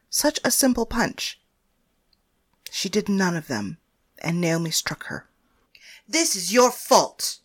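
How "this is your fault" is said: slow, bitter accusation; accusing. Acted. Naomi blaming Paris for the death, bitter. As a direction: lay the blame on her slowly. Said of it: "Naomi said".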